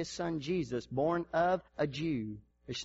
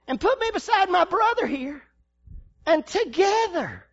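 preaching shout; 12 semitones higher, 6 dB louder than usual. Acted. Pastor declaring to the congregation, shouting. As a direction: preach it at full force.

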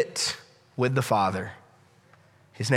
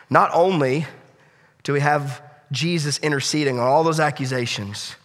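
ordinary talk, nothing marked

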